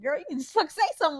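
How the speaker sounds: high, bright teasing push to the reader; mock-impatient girl talk; playful teasing. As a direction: nudge her, laughing, to spill it.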